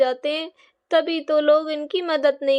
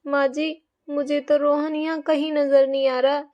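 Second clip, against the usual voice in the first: normal talking voice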